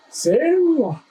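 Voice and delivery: high strained cry of effort; straining under weight. Real, not acted. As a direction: groan it out under the load. Lifting the heavy motor.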